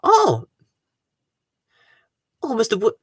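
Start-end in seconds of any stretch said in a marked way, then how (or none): none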